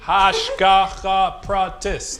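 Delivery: slow, drawn-out hashgacha pratis; Benji's pronunciation. Retold or imitated. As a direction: stretch hashgacha pratis slowly. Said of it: "That's how Benji says it".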